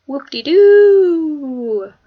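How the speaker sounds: drawn-out, high, loud mock cheer; sarcastic whoop. Real, not acted. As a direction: stretch it slowly into a fake hooray. Sarcastic cheer about being accepted.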